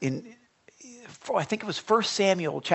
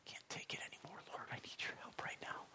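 breathy whispered plea, almost no voice; desperate whisper; 24 dB quieter, faster than usual. Shown as a demonstration. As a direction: whisper it like a prayer you can barely get out. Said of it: "You're whispering it".

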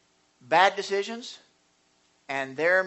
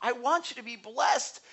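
God pleading; 9 semitones higher than usual. Acted. He's telling his people.

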